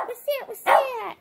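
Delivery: high, startled repeated question; pretend alarm. Pretend alarm after the chant.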